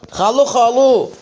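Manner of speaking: ordinary talk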